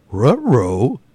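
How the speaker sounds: loud, swooping dog-like yelp; big exaggerated pitch swoops; cartoon dog catchphrase. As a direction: exclaim it like a startled cartoon dog. Cartoon dog catchphrase in episode title.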